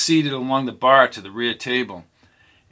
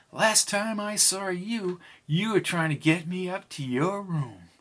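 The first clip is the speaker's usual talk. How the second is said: softly voiced saloon woman; a hint of a teasing woman; woman's voice; 6 semitones higher, 4 dB quieter than usual. Pam's voice in the story.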